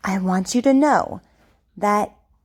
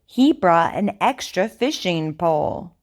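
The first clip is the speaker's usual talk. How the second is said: over enunciating. Shown as a demonstration. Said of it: "Repeat after me".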